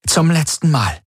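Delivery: high, strained final warning; desperate, trembling. A narrator's reading. Ylana's last warning to Galen, desperate. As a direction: warn with a touch of despair. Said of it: "das Zittern in ihrer Stimme".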